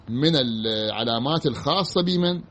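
ordinary talk, nothing marked

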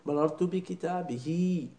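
بكتابه slowly with kasra, bikitabihi; slow model. Shown as a demonstration. Teacher modelling the kasra in بكتابه slowly. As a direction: stretch مررت بكتابه, the ha ending in i. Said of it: "إذا كانت مسبوقة بياء أو بكسرة".

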